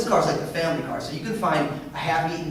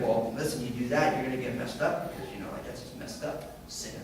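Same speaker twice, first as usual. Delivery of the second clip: lower, softer mock-condescending lecture; judgmental scold; 7 semitones lower, 9 dB quieter than usual. Mocking how Christians scold sinners, a low condescending voice. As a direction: sneer at the sinner under your breath. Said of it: "we have more time to be like".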